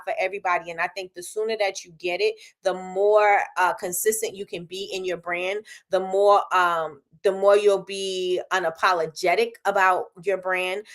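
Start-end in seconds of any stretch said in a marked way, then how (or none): none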